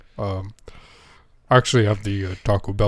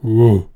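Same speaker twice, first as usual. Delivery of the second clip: mock dog bark; 10 dB louder than usual. Caller imitates Great Dane bark.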